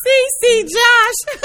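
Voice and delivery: very high, loud, drawn-out crow; hammed-up squealed taunt; mocking singsong. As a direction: gleefully stretch it out to needle. Gleeful mocking replay of favorite line.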